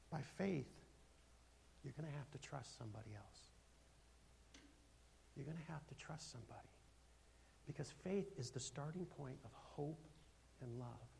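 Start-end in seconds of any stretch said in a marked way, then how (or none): 0.1-10.1 soft, tender advice; quiet pastoral reassurance; gentle counsel. Real, not acted. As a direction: ease her toward trust gently. Tender counsel to wounded woman.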